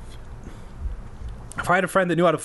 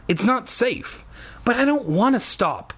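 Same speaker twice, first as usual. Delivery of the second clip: protesting; 10 semitones higher than usual. A narrator's reading. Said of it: "I protested".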